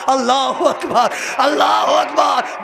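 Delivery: soaring, slowed wailing climax of a majlis reciter; پرجوش مرثیہ خوانی کی چیخ; full-force lament preaching. Acted. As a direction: drive the grief up to a shout, drawing the words out.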